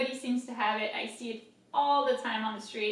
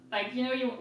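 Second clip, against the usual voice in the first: ordinary talk, nothing marked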